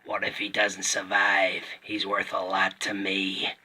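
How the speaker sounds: put-on slow American-accented bounty hunter; Boba Fett. Toy reviewer imitating the character's original voice, slow American accent. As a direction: draw it out slowly in an American film-villain voice. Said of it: "he used to sound like this".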